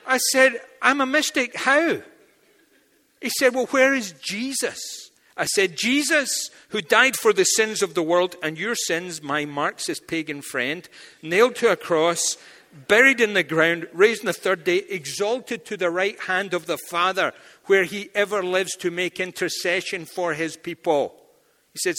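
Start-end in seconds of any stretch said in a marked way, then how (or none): 0.8-2.0 high surprised question. Retold or imitated. Surprised reply. 3.5-5.1 challenging retort. Retold or imitated. Marxist challenge. 5.8-21.2 full-force preaching. Acted.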